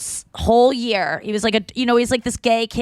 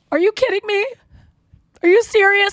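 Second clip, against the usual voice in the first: shocked disbelief; 11 semitones higher, 5 dB louder than usual. Retold or imitated. Aunt shocked by keys story.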